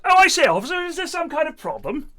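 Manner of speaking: high, hammed-up British gentleman's accent; exaggerated British accent. British driver to an American officer, caricatured British accent. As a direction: lay the Britishness on thick, pitched high. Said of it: "having a British accent".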